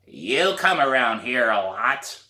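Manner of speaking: put-on raspy old lady, slow; like she swallowed sandpaper; hoarse old woman. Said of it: "It's a hoarse voice".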